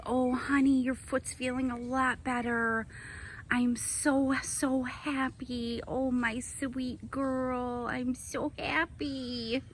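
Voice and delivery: soft and slow, deeply touched; tender. Real, not acted. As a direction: murmur it softly and slowly. Moved watching her pet eat.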